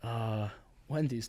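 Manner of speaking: low, soft, slow dad drawl; slow, soft father voice; dad's voice. Retold or imitated. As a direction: son doing his dad's voice for a laugh. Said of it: "that's how he sounds".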